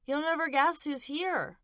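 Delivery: put-on high, eager little boy voice; bursting with news; excited child. Boy telling his mother, excited and high.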